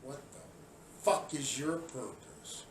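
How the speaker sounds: low, soft, disgusted question; quiet disgust. Real, not acted. Scolding protesters, low and soft.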